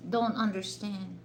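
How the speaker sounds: ordinary delivery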